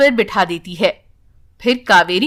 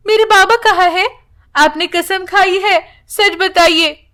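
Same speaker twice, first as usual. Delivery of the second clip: put-on higher, pleading childlike voice; young daughter's voice; 7 semitones higher than usual. Girl begs grandfather to swear, childlike higher voice. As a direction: lightly play a girl begging for the truth.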